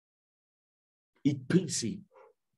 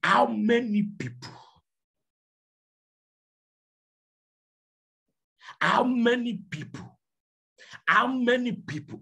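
rising preacher shout; 10 semitones higher than usual. Acted. Preacher challenging the congregation, high ringing shout. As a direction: hammer the question home to the crowd.

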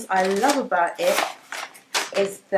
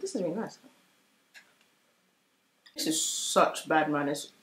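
talking through a mouthful of chocolate; chewing while speaking; mouth full; 4 semitones lower, 7 dB quieter than usual. Real, not acted. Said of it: "Talking with my mouth full".

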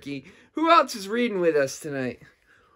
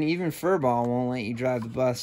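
plain reading voice

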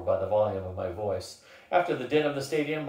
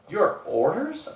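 slow, high, taken-aback question; stammered question; 8 semitones higher, 4 dB louder, slower than usual. A narrator's reading. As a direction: falter on a startled question. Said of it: "I stammered".

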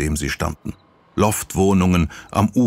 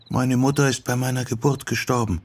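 put-on understated sorrow in a girl's voice; saddened; 6 semitones higher than usual. A narrator's reading. Sachiko tells Yoko of her mother's death, saddened. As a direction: say it with mild sadness, higher pitched. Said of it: "sagte Sachiko betrübt".

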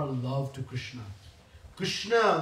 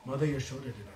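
softer, timid little confession; meek as a mouse; 5 semitones lower, 8 dB quieter, much faster than usual. Acted. Krishna meekly tells Balram who tied him, softly. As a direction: shrink as you name the culprit. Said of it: "He becomes like a mouse".

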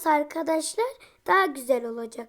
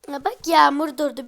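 ordinary talk, nothing marked

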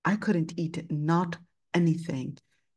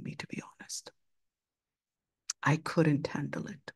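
hushed, breathy admission; whispering the words he can barely say; whispered confession; 2 semitones lower, 5 dB quieter than usual. Real, not acted. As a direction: let it slip out in a whisper. Confessing how upset he was.

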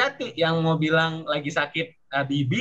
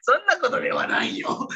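very high-pitched comic voice; playful character voice; 12 semitones higher than usual. Acted. Teacher jokes with learners, high playful character voice. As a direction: squeal it playfully, on the edge of laughter. Mock reply, then laughs.

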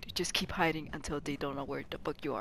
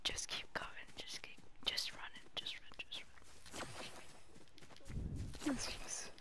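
tense whisper while hiding; hushed urgent whisper to a teammate; 7 semitones higher, 12 dB quieter than usual. Real, not acted. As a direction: whisper so they don't hear. Hiding from enemies in game.

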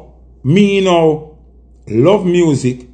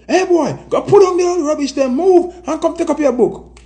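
much higher scolding Jamaican parent; 12 semitones higher than usual. Retold or imitated. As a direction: order the boy off like a strict parent. Parent scolding kid about radio.

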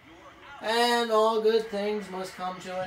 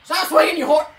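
higher, much louder scolding shout; angry yell; 5 semitones higher, 10 dB louder, faster than usual. Real, not acted. Gamer furious at the opposing batter, angry yell. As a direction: bark it at the batter.

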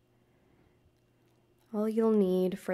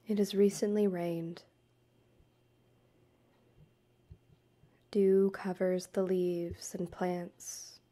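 guided meditation. Acted. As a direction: guide the listener gently through breath and forest imagery, unhurried.

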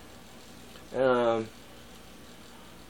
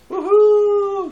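high loud whoop; excited cheer; 7 dB louder than usual. Real, not acted. Vlogger cheering about one hundred, high and loud. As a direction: whoop with joy.

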